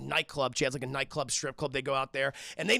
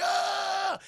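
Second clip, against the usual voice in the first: long high mock wail; imitated pain scream; 13 semitones higher than usual. Salt in the bullet wound, mock scream of pain.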